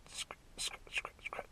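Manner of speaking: breathy scratch scratch noise, almost silent; whispered scratching noise. Retold or imitated. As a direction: mimic a lock being scratched, under your breath. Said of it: "a scratch sound at the door".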